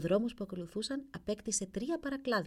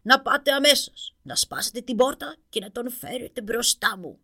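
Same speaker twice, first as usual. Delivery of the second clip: furious king shouting orders, loud; angry shout; 2 semitones higher, 9 dB louder than usual. A narrator's reading. King Boni orders the door broken, furious shouting. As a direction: bellow at the courtiers in rage. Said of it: "φώναξε θυμωμένος".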